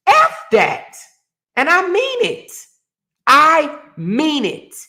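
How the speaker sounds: heated, emphatic last word; furious dismissal. Real, not acted. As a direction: slam the door on it.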